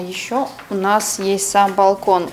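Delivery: usual speaking voice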